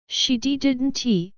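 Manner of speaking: a light break on the stutter. A narrator's reading. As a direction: stumble as the voice gives way. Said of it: "His voice broke".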